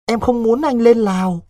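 put-on a frightened girl begging her lover to stay; pleading young girl. A narrator's reading. Vịnh begging Đối not to go to Laos, high and pleading.

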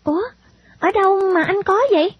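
high-pitched, wondering question; surprised little girl. Acted. Girl surprised by boy's clogs.

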